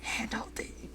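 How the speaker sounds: hushed whisper; very soft, whispered aside; giddy whisper. Real, not acted. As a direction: whisper it like a secret thrill. Repeats it in a whisper.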